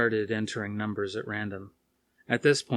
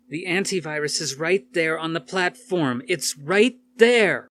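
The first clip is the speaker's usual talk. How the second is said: livid shout; 7 semitones higher, 8 dB louder than usual. Acted. Alice furious with the Red Queen, shouting. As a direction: hammer it home in fury.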